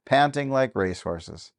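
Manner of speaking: ordinary delivery, nothing marked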